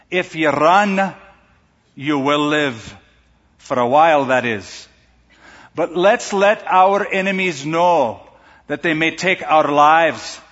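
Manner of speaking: a slow, lightly put-on Scottish accent, rallying the troops; Scottish brogue, William Wallace rousing his men, slowly; attempted Scottish accent. Preacher retells Wallace's speech to his men in a mild Scottish accent. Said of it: "Pardon the accent. I give it my best shot".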